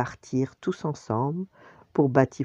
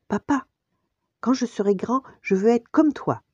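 high-pitched, louder little cub voice; eager child's voice, pitched up high; young Simba; 9 semitones higher, 4 dB louder than usual. A narrator's reading. Cub telling his father he wants to be like him, high child voice. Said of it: "dit Simba à son père".

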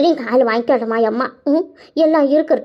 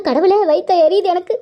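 usual speaking voice

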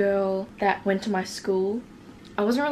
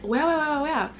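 higher, drawn-out flattered reply; mock gushing; 5 semitones higher, much slower than usual. Her old self thanks the girl, mock gushing. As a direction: gush in a put-on higher voice. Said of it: "So I said".

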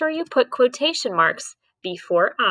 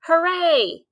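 high-pitched, mildly raised cheer; mild shout; 9 semitones higher than usual. A narrator's reading. Teacher reading a class's shout to third graders, mild cheer. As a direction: read it as a light, higher-pitched classroom cheer. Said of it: "the class shouted".

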